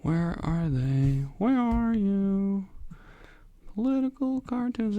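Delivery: high, slow, drawn-out plea; high searching plea. Real, not acted. Hunting for missing slides.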